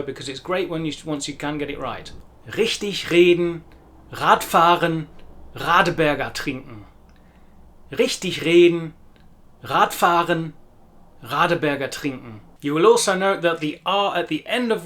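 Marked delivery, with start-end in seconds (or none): 2.5-12.4 "Richtig reden, Radfahren, Radeberger trinken" with R made in the throat; German throat R. Shown as a demonstration. Teacher demonstrating practice phrases, standard German throat R. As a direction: sound every initial R at the back of the throat. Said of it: "The standard German R sound".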